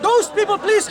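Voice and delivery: normal talking voice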